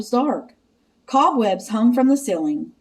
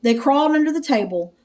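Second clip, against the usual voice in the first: ordinary delivery, nothing marked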